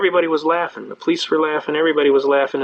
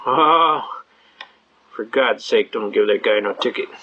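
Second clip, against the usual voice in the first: low, gravelly-free deep mock cop voice; gruff cop; 6 semitones lower, slower than usual. Regular cop waving off a ticket, low mock voice. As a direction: drop low like a fed-up cop.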